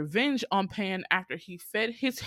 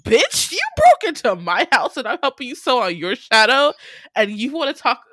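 loud, high-pitched mock outrage; indignant telling-off; 4 semitones higher, 9 dB louder than usual. Viewer scolding Peter for cutting Wendy off, indignant telling-off. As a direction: tear into him in comic indignation.

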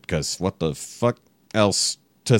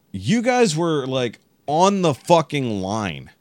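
indignant retort; 7 semitones higher, much slower than usual. Retold or imitated. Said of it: "that's what I told them".

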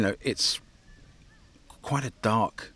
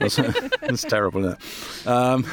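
laughing; 4 semitones higher, 4 dB louder, slower than usual. Real, not acted. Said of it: "laughing at own accent attempt".

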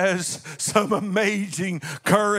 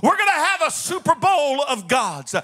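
raised, driving preacher's voice building to a shout; full-force preaching; 5 semitones higher than usual. Acted. Preacher hyping the Super Bowl of gods to his congregation, full-force preaching. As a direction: preach it out to the congregation like a showdown announcement.

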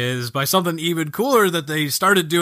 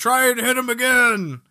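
a mock battle callout, pitched up; game voice line; 8 semitones higher than usual. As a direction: urge like an in-game ally. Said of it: "or, like".

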